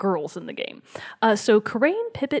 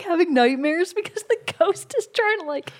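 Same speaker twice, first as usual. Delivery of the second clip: ordinary talk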